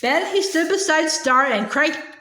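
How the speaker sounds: usual speaking voice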